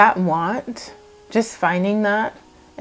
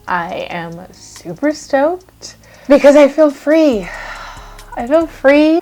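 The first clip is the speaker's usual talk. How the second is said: high, loud and elated, slowing on super stoked; real elation, freer and louder; excited; 8 semitones higher, 9 dB louder, slower than usual. Real, not acted. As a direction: burst out with relief and joy. Joyful declaration of freedom.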